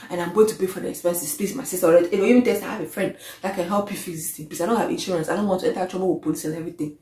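hushed, imitated plea from a driver with no insurance; pleading driver. Driver without insurance softly begging the woman he hit to skip the police, imitated pleading. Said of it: "he's like".

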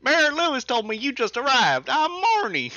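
loud, high, slow southern holler; southern accent. Acted. Player voicing Marnie the farmer, hammed-up southern accent. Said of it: "they all probably have really southern accents".

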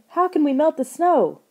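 high, loud desperate plea. A narrator's reading. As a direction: beg for an answer. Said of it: "Lauren cried".

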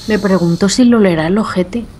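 hushed whisper. Real, not acted. Unvoiced whisper.

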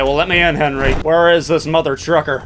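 normal talking voice

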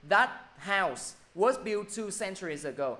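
teacher dictating an example sentence; slow example reading. Shown as a demonstration. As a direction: read it out slowly for the class. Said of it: "Ví dụ".

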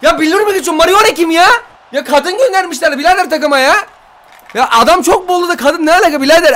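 high-pitched, incredulous complaining; outraged disbelief. Real, not acted. Gamer complaining about the player sent, outraged disbelief. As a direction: protest in a high, exasperated voice.

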